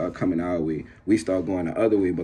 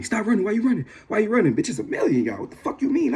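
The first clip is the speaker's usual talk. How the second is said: high, agitated acted cries; high-pitched chase protest; 13 semitones higher than usual. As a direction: argue on the run, voice pitched way up. Acted shouts during a chase.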